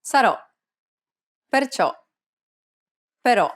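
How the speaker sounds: "Sarò, Perciò, Però" with open, stressed final O as in "ought"; open Italian O. Shown as a demonstration. Teacher showing the grave accented O, open Italian O. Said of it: "we have the grave accented O".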